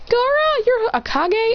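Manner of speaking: shocked high exclamation; astonished. Acted. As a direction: squeal it in disbelief.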